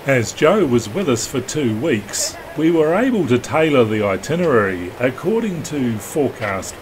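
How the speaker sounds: even, measured documentary read; calm voiceover read to the viewers; vlog voiceover. Acted. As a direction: narrate the passage like a sailing film.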